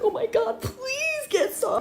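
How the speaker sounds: high, slow, crying whine; whining plea. Retold or imitated. Performer retells begging her co-star, slow high tearful whine. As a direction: drag out the begging like near tears. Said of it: "And I'm like".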